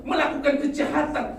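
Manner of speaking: high, loud indignant protest; loud, aggrieved prophet's complaint; shouted complaint. Retold or imitated. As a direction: protest loudly at the wicked. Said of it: "Lalu dikatakan".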